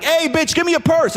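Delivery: high-pitched mock mugger; mugger's demand. Criminal coming up to his mother, demanding.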